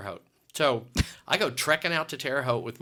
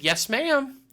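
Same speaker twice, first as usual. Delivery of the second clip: high, louder sheepish answer; sheepish yes; 12 semitones higher, 8 dB louder than usual. Retold or imitated. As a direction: own up meekly. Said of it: "And I said".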